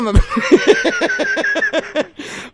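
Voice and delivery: a shrill, gleeful cackle; victorious prank laugh; high cackling laugh. Real, not acted. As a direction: cackle at the angry caller.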